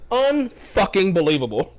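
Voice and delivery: "unfucking believable" in slow, exasperated emphasis; emphatic disbelief. Retold or imitated. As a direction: stretch "unfucking believable" with heavy stress on each part. Colleague's exasperated repeat, drawn out.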